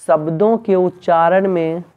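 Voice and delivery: measured phrases with long pauses; slow dictation. Real, not acted. Teacher dictates the definition of Sangam, slow dictation. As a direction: dictate in small pieces, waiting between them.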